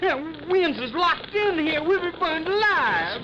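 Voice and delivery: yelling in terror as smoke fills the room; panicked cry. Acted. Locked inside burning shack.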